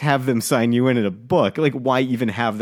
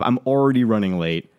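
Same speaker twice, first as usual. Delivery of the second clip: ordinary talk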